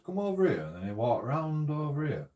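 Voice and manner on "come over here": calling from far away. Retold or imitated. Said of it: "he says".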